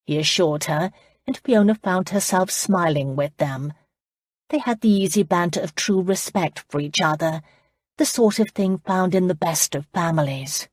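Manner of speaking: usual speaking voice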